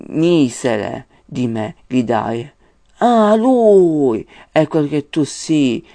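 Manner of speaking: slow, loud, solemn reading of the verses; poem recitation. Acted. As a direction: declaim Dante like a stage reciter, drawing each line out. Recites Dante's verses aloud.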